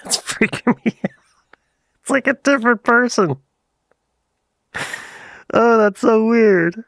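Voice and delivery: high-pitched giggly disbelief; freaked out. Real, not acted. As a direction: squeal it, half laughing and unnerved. Freaked out by his accent.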